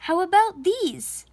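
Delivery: bright saleslady. Acted. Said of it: "said the saleslady".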